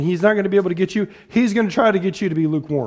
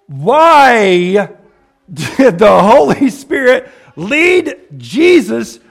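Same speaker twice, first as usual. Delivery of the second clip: loud, slow, drawn-out question; booming, stretched-out rhetorical question; emphatic preaching question; 3 semitones higher, 7 dB louder, much slower than usual. Real, not acted. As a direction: hammer out each word to the congregation. Preacher drives home a key question.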